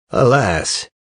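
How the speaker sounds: ordinary delivery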